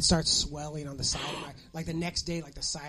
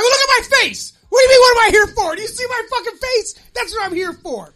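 high, loud indignant outburst; hammed-up furious rant; exasperated shouting; 15 dB louder than usual. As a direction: yell in disbelief at an obvious question. Acted outburst at the nurse.

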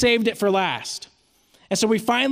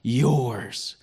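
tender offer; 5 semitones lower, much slower than usual. Retold or imitated. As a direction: hand it over gently, drawing it out. Dad offers treat to toddler.

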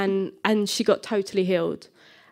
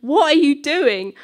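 higher-pitched, drawn-out self-scolding; inner alarm; 5 semitones higher, 3 dB louder, much slower than usual. Retold or imitated. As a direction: scold yourself in mock alarm. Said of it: "inside I'm like".

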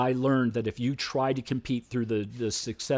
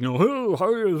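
awkward eager greeting; 8 semitones higher, 5 dB louder than usual. Retold or imitated. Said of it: "couldn't speak to women without".

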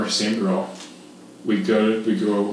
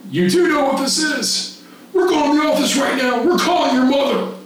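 high, louder, no-nonsense command; stern order; 3 dB louder than usual. Retold or imitated. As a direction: lay down the punishment. Director orders boy to office.

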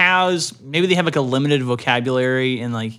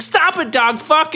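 mock cow protest; 12 semitones higher, 3 dB louder than usual. Cow being bitten by dog.

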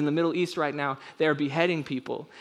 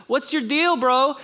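high, loud flippant jeer; mocking crowd; 10 semitones higher, 5 dB louder, slower than usual. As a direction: toss it off like a scornful bro. Voicing jeering crowd.

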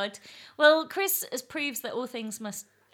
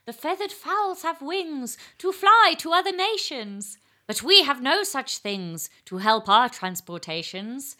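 slow, high declaiming, rising to a crescendo; dramatic eel's battle cry, drawn out and growing louder; small-eyed eel voice; 4 semitones higher, 9 dB louder than usual. Acted. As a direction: recite as a tiny-eyed eel, building to a shouted finish. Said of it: "as if your eyes are really small".

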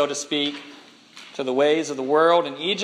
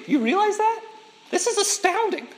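amazed. Real, not acted. Preacher marvels at God's love.

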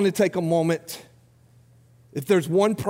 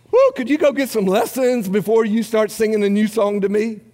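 higher-pitched, hammed-up critical plea; mock exasperation; 5 semitones higher, 4 dB louder than usual. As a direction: act out a put-upon listener begging for relief. Acting out God mocking bad singing.

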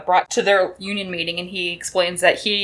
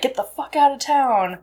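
a retold yell, pitched higher; mock shout; 6 semitones higher than usual. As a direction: bark it out like the angry mob.

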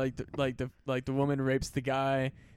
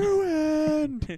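high, sobbing one-word wail; fake-cry it; mock sob; 14 semitones higher, 4 dB louder than usual. Mock crying.

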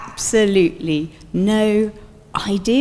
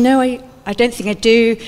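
usual speaking voice

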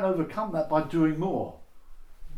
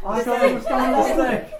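whiny confession; 9 semitones higher, 10 dB louder than usual. Acted. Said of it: "And you say".

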